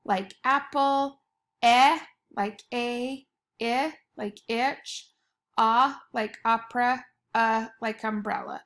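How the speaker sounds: each short vowel sounded out clearly; teacher sounding out short vowels; vowel sounds demo. Shown as a demonstration. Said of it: "just the vowels that have".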